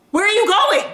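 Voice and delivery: high, startled question; mock alarm. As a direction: act panicked at being left alone. Acts alarm at being left alone.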